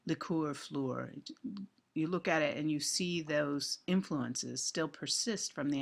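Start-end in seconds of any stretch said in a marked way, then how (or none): 0.1-1.1 "liqueur fleur" slow, both ending in the same oor sound; old French-influenced vowels on "liqueur fleur"; Middle English rhyme. Shown as a demonstration. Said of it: "the end rhyme".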